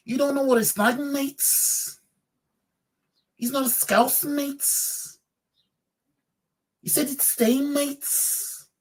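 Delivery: a bad Scouse impression of an angry Liverpool fan; mock Scouse accent. Streamer imitates Liverpool fans answering Klopp, in a hammed-up Scouse accent. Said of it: "I can't do a Scouse accent that good".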